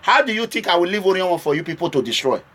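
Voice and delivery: ordinary delivery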